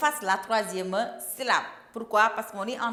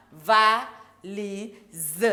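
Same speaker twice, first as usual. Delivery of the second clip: "valise" syllable by syllable, slowly; separado en sílabas; 6 dB louder than usual. Shown as a demonstration. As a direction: break "valise" into three slow, spaced syllables. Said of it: "de syllabes".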